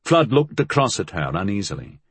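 ordinary delivery

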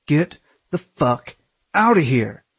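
slow, loud threatening shout; angry. Acted. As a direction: yell it at him, furious. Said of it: "Aaron got angry".

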